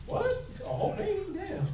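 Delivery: mock disbelief. Speaker mocking the claim a pit bull ate a baby, laughing disbelief. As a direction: act stunned by the ridiculous claim.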